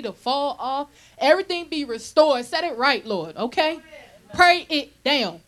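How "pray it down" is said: loud, slow, drawn-out command; preaching exhortation. Real, not acted. Preacher urging the congregation to pray, loud and slow. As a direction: call it down with force. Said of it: "exhorting the congregation".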